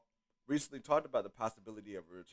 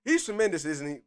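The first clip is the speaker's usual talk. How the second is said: excited quote; 6 semitones higher, 8 dB louder than usual. Retold or imitated. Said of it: "he says".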